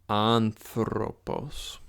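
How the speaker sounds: anthropos said very slowly and carefully, syllable by syllable; slow model pronunciation of anthropos; Greek pronunciation. Shown as a demonstration. Teacher repeating anthropos for students, slow and careful. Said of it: "And again, last time".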